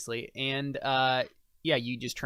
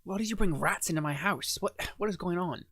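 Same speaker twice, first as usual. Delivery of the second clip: lightly voiced NPC; 6 semitones higher than usual. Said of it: "she's like".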